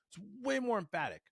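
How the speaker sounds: high-pitched emphasis. Shown as a demonstration. Coach repeating the point for effect, high-pitched emphatic voice.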